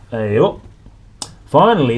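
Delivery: plain reading voice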